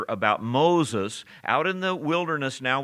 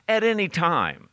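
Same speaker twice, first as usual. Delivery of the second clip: "at any time" punched higher; "at any time" stressed as the final point; emphatic ending; 6 semitones higher, 4 dB louder than usual. Shown as a demonstration. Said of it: "there's a second emphasis".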